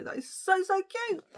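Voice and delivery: a delighted high squeal of so cute; gushing excitement. Real, not acted. Book lover to viewers, gushing over the yellow inside cover, excited high squeal.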